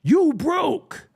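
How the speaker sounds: high, loud jeering taunt; hammed-up high-pitched put-down; mocking diss. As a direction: throw it like a playground insult. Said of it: "the number one retort".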